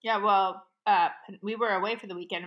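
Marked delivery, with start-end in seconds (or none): none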